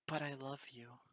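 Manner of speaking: sniffling whimper. Acted. Weeping pony confessing love to the mare, sniffling. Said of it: "I sniffled and whimpered forth".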